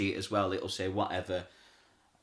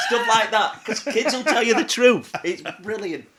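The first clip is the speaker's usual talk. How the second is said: laughing; 11 semitones higher, 9 dB louder than usual. Real, not acted. Laughing while telling.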